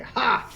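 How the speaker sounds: sudden high-pitched "Ha!"; acted "ha". Player announces picking up a rock, high exclaimed "ha". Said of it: "pick up a rock and say".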